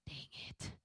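whispered under her breath, very soft; mumbled to herself. Retold or imitated. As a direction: mutter it to yourself in regret. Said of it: "I was like".

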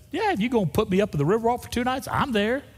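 high, fast, jokey enthusiasm; eager acceptance. Retold or imitated. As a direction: jump at the free offer, playfully. Acts out his eager acceptance.

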